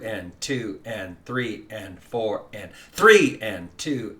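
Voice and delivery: slow count with the first beat punched; accent the one. Shown as a demonstration. Teacher counting along with students, accenting beat one. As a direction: lean on the downbeat, count the rest lightly. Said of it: "Let's do this together".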